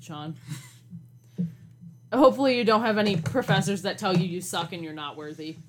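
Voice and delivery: ordinary delivery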